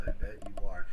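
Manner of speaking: low, sultry flirtation. Acted. Game master showing how he looks up at you, sultry. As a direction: drop the pitch and flirt sultrily. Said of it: "it's a sultry, like, voice of".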